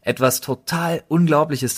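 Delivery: normal talking voice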